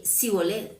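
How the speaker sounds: 'Sibolet' with s instead of sh; mispronounced Shibolet. Retold or imitated. Efraimites unable to say the password, 'Sibolet' with s. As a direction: say 'Sibolet' with a plain s. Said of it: "no sabían pronunciar bien esa palabra".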